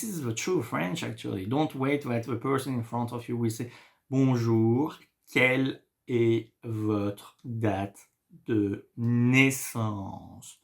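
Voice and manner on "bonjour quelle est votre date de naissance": drawn-out, word by word; mock the slow textbook way; overly slow. Shown as a demonstration. Teacher mocking slow textbook French, drawn out. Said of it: "Nobody talk like that in France".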